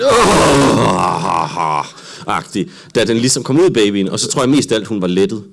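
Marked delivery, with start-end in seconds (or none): 0.0-1.8 long, loud groan sliding down; imitated relieved groan. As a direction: let out a huge mock sigh of relief. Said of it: "lettet, sådan". (højt, langtrukket lettelsesstøn, efterlignet)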